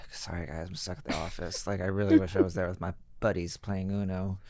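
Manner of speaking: soft, deliberately tired voice note. Retold or imitated. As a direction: sound worn out on purpose. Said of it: "intentionally tired sounding voice note".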